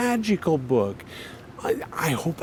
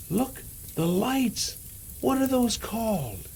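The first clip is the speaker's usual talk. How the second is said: awed gasp; 6 semitones higher than usual. Acted. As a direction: gasp at the sky in wonder. Santa sees Northern Lights.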